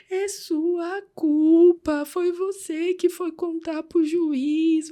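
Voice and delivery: high, drawn-out wailing accusation; anguished screaming. Retold or imitated. Boy blaming his carer for losing his grandmother, anguished cry. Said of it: "E ele gritava".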